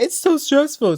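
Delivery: high, whiny mock of a stressed wife; mock stressed whine. Mocking Margaret to the viewers, a high stressed whine. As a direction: whine it like she's overwhelmed. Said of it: "Margaret's like".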